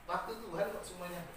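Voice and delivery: usual speaking voice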